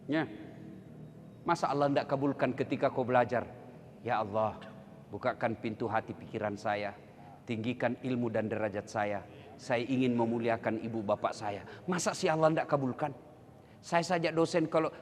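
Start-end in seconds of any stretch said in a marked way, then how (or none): 4.1-11.7 humble prayer. Acted. Voiced student's prayer.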